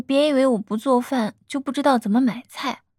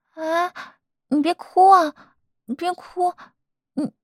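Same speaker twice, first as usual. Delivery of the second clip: higher-pitched, fussing plea to stop crying; alarmed soothing; 7 semitones higher, slower than usual. Acted. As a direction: hush a tearful partner with rising concern. Comforting a partner who starts crying.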